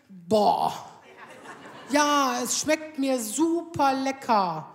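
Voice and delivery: ein gedehntes, genervtes Boah, dann lustlos langsames Lob; widerwilliger Ehemann. Retold or imitated. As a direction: lob das Essen, als würde es wehtun. Husband grudgingly praises her food. (Ehemann lobt ihr Essen: widerwillig gedehnt)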